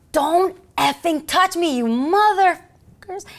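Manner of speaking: high, loud, slow furious yell, imitated; screaming suspect. Suspect shuffling away from the officers, screaming. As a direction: stretch each word of the mock scream. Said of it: "And he's screaming".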